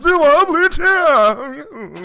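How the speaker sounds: grand announcer. Pretend. Host presents the Wobbly Chair to listeners, booming slow announcer. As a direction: announce him like a ringside MC, very slow. Introducing a friend's arrival.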